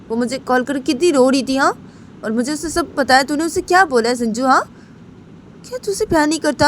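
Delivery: confronting him for making her cry; scolding friend. Acted. (डाँटती सहेली की ऊँची आवाज़)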